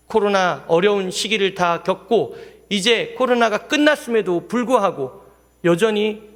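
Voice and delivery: ordinary talk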